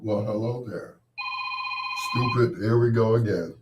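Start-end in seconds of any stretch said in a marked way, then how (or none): none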